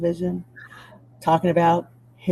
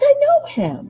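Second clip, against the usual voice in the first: excited recognition; 5 dB louder, slower than usual. Retold or imitated. Guest recalls seeing him on TV, high excited realisation. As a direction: voice leaps much higher with a laugh.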